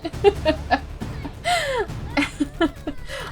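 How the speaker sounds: high delighted cackle; high cackling laugh. Real, not acted. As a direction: cackle at the brawl starting. Fight breaks out in game.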